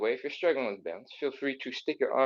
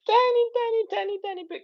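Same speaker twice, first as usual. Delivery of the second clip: squeaky tiny; 5 dB louder than usual. Acted. Coaxing a deeper stretch.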